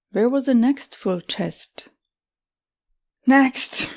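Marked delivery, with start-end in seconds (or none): 3.3-3.9 hoarse rasped cry; raspy whisper. Acted. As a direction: rasp it out, exhausted. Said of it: "she rasped".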